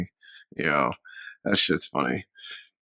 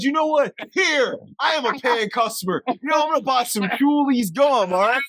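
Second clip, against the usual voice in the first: mock customer; 12 semitones higher than usual. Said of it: "He said".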